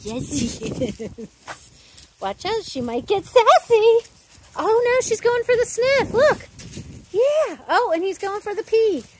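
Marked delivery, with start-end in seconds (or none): 2.2-9.0 high, loud running commentary; excited commentary. Real, not acted. Trainer calling the dogs' meeting, high and loud. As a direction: call every move with rising excitement.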